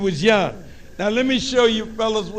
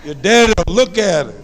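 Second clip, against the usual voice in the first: ordinary talk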